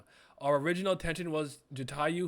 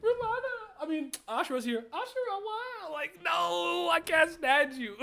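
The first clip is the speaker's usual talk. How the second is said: very high squealing reaction with laughs; excited shriek; 3 dB louder than usual. Real, not acted. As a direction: squeal at the reveal.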